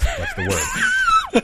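high, shrieking laugh through the words; laughing too hard to finish the line; bursting into laughter. Real, not acted. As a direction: crack up completely mid-sentence. Recalling the prank, cracks up.

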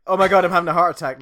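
fast, loud hammed-up panic; over-the-top heart attack. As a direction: ham up a fake heart attack. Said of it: "really big bug out eyes".

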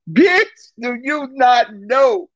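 high and loud, then slowing in disbelief; incredulous. Real, not acted. Telling viewers about his confession, loud high incredulous. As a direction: stretch it out, stunned.

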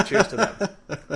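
slow toast through a laugh. Real, not acted. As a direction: raise a glass, chuckling. Toast after joke, laughing.

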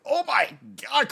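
high-pitched, drawn-out exclamation of amazement; astonished reader. Retold or imitated. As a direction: re-enact being blown away, slow and way up high. Acting out own reaction while reading.

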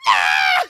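a sudden loud imitated scream of pain; mock scream. Mom in the shower, screaming over her broken toe. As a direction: belt out a short pained "Ah!".

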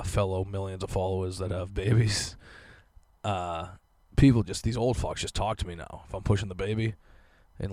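usual speaking voice

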